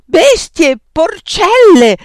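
high, loud, outraged shriek; scandalized yell; screamed insults. A narrator's reading. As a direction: scream it at them, stretching the last word. Arrow screams insults at friends.

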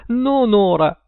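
high, strident little refusal; shrill voice. A narrator's reading. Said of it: "disse Paul con voce stridula". (acuto, con voce stridula)

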